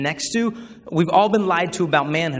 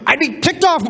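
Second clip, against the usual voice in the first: high, loud hammed-up outburst; outraged classmate; 12 semitones higher, 6 dB louder than usual. Classmate butting in about the future wife, loud high mock outrage. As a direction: burst out in mock indignation.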